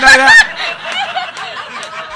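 mock announcement. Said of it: "mock shout to audience".

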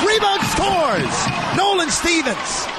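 high, excited scoring shout; goal call. Real, not acted. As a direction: erupt on the goal.

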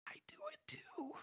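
a fake secret, whispered right into his ear; breathy hushed whisper, leaning in; whispered confession. The cop confiding to the suspect, whispering conspiratorially.

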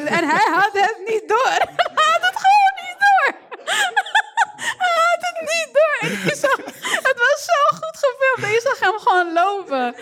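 high, helpless laughter; laughing hard. Real, not acted. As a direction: crack up laughing through words. Cracking up over the video.

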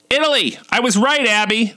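high-pitched calling across a room; mock scream. Losing her mind, calling across the room to Abby, mock scream. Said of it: "I screamed across the room to Abby".

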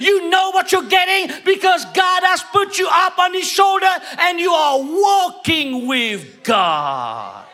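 loud, high, fired-up preaching; rising sermon climax, then easing off; preaching at full force. Acted. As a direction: drive the point home to the congregation.

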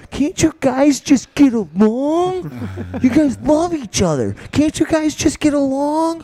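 high, loud, slow whiny plea; pleading friend. Retold or imitated. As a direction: beg the dogs like a sappy drunk. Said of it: "He's like".

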